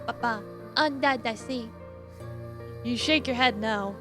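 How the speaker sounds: ordinary talk, nothing marked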